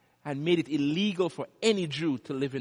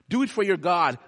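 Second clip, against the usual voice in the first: louder, pressing appeal; loud exhortation; 4 semitones higher, 7 dB louder than usual. Shown as a demonstration. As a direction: push it out to the church.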